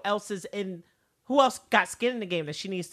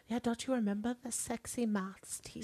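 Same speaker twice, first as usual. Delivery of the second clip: soft teasing voice; 4 semitones higher, 9 dB quieter than usual. Retold or imitated. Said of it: "Villanelle's like".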